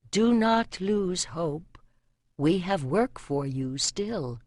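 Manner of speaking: slow, soft voice from within; divine inner voice. A narrator's reading. Goddess answering the archer's prayer, slow and soft. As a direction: speak slowly, like a goddess in his soul. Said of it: "a voice in the silence of his soul".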